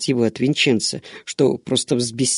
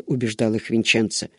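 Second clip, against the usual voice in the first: usual speaking voice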